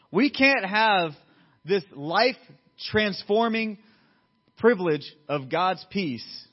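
slow, loud, weighty pulpit phrasing; deliberate sermon emphasis, starting high; emphatic preaching. Real, not acted. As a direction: space out each phrase for the congregation.

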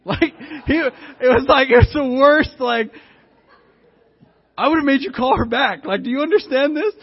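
high, loud exasperated outburst; loud incredulity. Real, not acted. Preacher to congregation about his friend's awful plan, loudly incredulous.

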